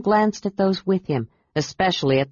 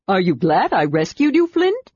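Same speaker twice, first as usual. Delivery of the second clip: Tasselhoff; 2 semitones higher, 3 dB louder than usual. A narrator's reading. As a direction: needle him playfully, voice soaring on the question. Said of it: "asked Tasselhoff".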